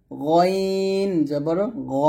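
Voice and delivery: গইন drawn out long; stretched গইন, then rounded "ghaw" গ; model Ghain recitation. Shown as a demonstration. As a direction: slowly intone গইন from the throat. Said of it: "এরকমভাবে আপনারা সুন্দর করে".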